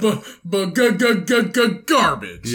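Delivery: teasing stammered garbage; mock stutter. Mocking his friend's flub with a fake stutter. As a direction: stutter on purpose to mock him. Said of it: "mocking stutter".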